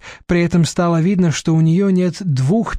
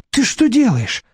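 higher, indignant protest; trying to be indignant; 7 semitones higher than usual. A narrator's reading. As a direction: protest with a rising voice. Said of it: "попыталась возмутиться хозяйка".

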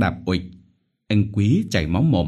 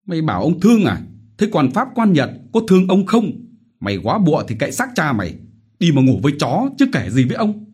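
fast, higher scornful tirade; cruel mocking shout; 9 semitones higher, 4 dB louder, faster than usual. Chief mocking the begging widow, scornful shouting. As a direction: tear into her with contempt.

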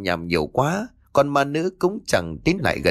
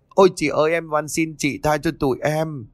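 terrified screaming plea; 4 semitones higher than usual. A narrator's reading. The boys begging the ghost for mercy, terrified outcry. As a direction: shriek your begging. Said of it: "liền hét toáng lên".

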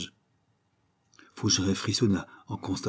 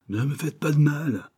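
put-on higher-pitched street-thug accent; riffraff accent; 7 semitones higher than usual. A narrator's reading. Caught detective posing as a burglar, riffraff accent. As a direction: plead like a petty crook, in riffraff accent. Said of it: "en prenant un accent canaille".

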